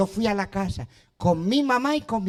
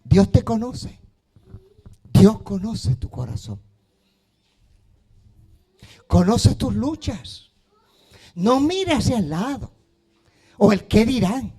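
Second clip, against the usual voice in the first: rising, louder preaching over music; building preaching; 4 dB louder than usual. Acted. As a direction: build the appeal with fervor. (predicación que crece en fervor)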